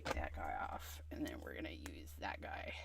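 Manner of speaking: soft whispered aside. Real, not acted. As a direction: whisper the steps.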